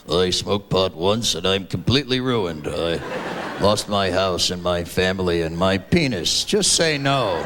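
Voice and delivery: low, croaky anti-drug ad victim; ruined man in ad. Retold or imitated. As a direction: voice a wrecked burnout pleading.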